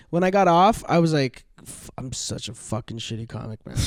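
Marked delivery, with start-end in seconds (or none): 1.6-3.8 much softer, under the breath; a hushed aside to yourself; muttered self-loathing. Retold or imitated. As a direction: beat yourself up quietly after a gig. Harsh self-talk after a show.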